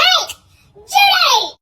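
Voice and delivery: high, loud call to get attention; calling out sharply. Retold or imitated. Snapping Judy out of her trance, loud high holler. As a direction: holler the name to snap someone awake. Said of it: "snap Judy out of her trance".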